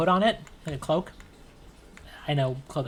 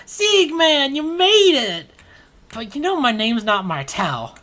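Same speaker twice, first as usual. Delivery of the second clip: much higher, loud gushing welcome; overjoyed friend; 10 semitones higher, 7 dB louder, slower than usual. Acted. Marty hugging his old friend Sieg, high and thrilled. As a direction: burst out with reunion joy. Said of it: "hugs you tightly and goes".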